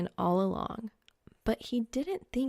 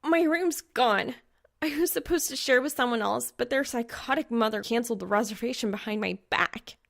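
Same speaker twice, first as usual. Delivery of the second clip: outraged; 4 semitones higher than usual. Acted. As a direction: vent the fury. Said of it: "her earth-melting outrage".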